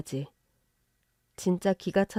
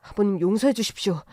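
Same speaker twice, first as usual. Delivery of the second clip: understated begging son-in-law; pleading for forgiveness. Acted. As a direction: plead humbly with father-in-law. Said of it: "무릎을 꿇고 앉아서 싹싹 빌더라고요".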